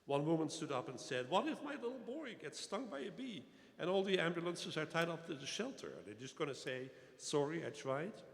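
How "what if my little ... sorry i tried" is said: higher-pitched, agitated worried-mother protest; indignant townswoman. Retold or imitated. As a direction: retell an upset objection at a town meeting. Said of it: "exploded in anger".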